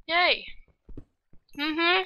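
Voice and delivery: high, bright little cheer; excited yay. Real, not acted. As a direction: cheer to yourself, pleased. Pleased to have progress.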